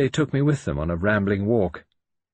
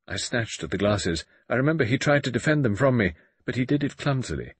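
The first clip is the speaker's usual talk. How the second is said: usual speaking voice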